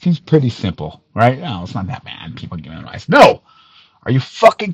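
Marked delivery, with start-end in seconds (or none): 1.4-3.1 mocking critics in a low, much softer voice; a sneering little imitation, tossed off under the breath; mock dismissive. Mocking the critics' excuse, low and dismissive. 3.1-3.4 a sudden, much higher, louder no; sharp loud no. Real, not acted. Cutting off the excuse with a loud no. Angry rebuttal in rant.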